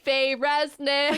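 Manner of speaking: loud, slow, drawn-out name; mock fan demand. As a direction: stretch the name like a devoted fan. Acting out own demand for Faye.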